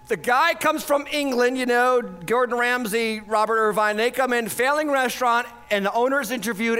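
ordinary delivery

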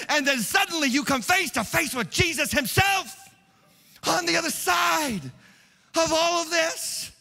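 high, impassioned preacher's delivery; fervent preaching. Real, not acted. Preacher tells the congregation of Mary's joy returning, fervent preaching. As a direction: preach it at a high, driving pitch.

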